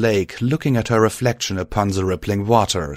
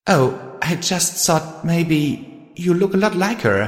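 squeaky tearful; 9 semitones higher than usual. A narrator's reading. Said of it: "the filly squeaked out through her tears".

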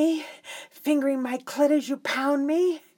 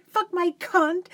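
normal talking voice

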